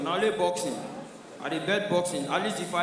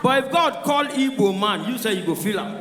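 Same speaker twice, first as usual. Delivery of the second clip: ordinary delivery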